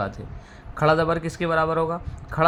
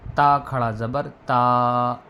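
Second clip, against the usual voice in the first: drawn-out reading; 4 dB louder, slower than usual. Shown as a demonstration. Teacher showing students how long to hold बा. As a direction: stretch the final बा slowly and long. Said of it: "एक अलिफ के बराबर".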